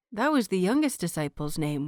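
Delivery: ordinary delivery, nothing marked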